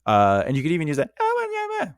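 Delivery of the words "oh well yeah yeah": very high comic falsetto babble; silly put-on voice. Shown as a demonstration. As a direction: demonstrate an absurdly high, silly voice. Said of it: "that voice".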